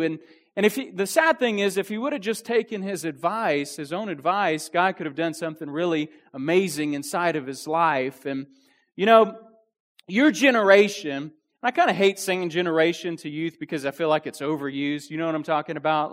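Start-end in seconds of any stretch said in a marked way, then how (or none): none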